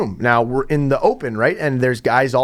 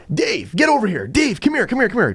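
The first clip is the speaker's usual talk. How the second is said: urgent calling; 7 semitones higher than usual. Retold or imitated. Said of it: "I was like".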